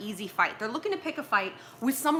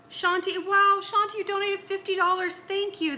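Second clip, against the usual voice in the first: higher, louder delighted thanks to a donor; light up thanking Shanti; excited thanks; 9 semitones higher, 4 dB louder than usual. Real, not acted.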